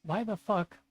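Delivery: real frustration; exasperated why. Real, not acted. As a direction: throw up your hands. Frustrated rant about frame capture.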